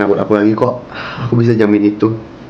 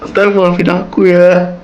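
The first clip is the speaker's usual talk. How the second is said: slow, higher, pleading sorry; tearful apology; 8 semitones higher, much slower than usual. Acted. (permintaan maaf yang sedih dan lambat)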